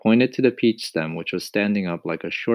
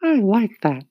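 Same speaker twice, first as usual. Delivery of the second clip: high loud indignant retort; 7 dB louder than usual. A narrator's reading. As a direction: huff it, offended. Indignant reply.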